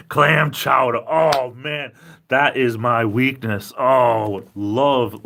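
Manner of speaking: emphatic, drawn-out food rapture; excited craving. Real, not acted. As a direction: gush about your favourite food, loudly. Raving about favourite food.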